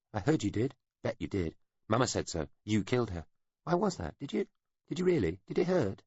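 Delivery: put-on high, needling child voice pressing the question; taunting child. A narrator's reading. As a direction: poke at him like a cruel playground kid.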